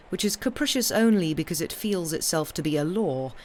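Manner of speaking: usual speaking voice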